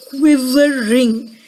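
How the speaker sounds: QUIVERING said slowly, syllable by syllable; drawn-out demonstration. Shown as a demonstration. Storyteller teaches children a new word, slow drawn-out quivering. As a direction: stretch out quivering for children to copy. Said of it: "Can you say it with me?".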